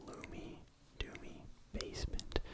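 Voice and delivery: ordinary talk, nothing marked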